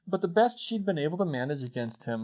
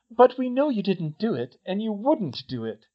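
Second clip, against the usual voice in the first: higher-pitched, emphatic reassurance; insistent; 7 semitones higher, 5 dB louder than usual. A narrator's reading. Hermione defending Harry to himself, insistent. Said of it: "she insisted".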